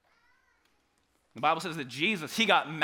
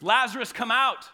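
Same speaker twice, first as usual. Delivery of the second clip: loud, raised call to the tomb; a commanding shout into the tomb; 6 semitones higher, 7 dB louder than usual. Pretend. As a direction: call him out of the grave. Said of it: "Jesus says".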